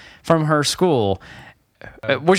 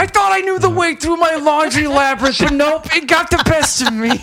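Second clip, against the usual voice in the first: high-pitched theatrical lament, much higher than usual; melodramatic homeowner; 7 semitones higher than usual. Acted. Homeowner bemoaning own laundry maze, melodramatic high voice. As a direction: wail it in a put-on high voice.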